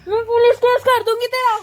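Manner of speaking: loud furious threat; angry threat. Acted. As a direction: shout the threat at him. Victim threatening captor.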